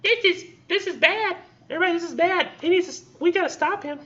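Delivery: squeaky little bird voice, way up high; tiny panicking birds. Acted. Teacher voicing little desert birds in a squeaky panic. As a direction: play a tiny frantic bird crying alarm. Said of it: "these little birds going".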